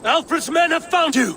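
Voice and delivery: high, urgent call across the fight; urgent warning shout. Acted. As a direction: shout the alarm to Rollo.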